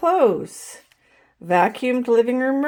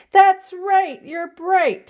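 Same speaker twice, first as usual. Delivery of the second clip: cheerful praise; 8 semitones higher, 4 dB louder, slower than usual. A narrator's reading. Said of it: "said Missus Ruler".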